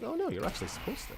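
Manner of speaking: high, fast mocking game voice; chirpy scolding game voice. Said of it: "the game's like".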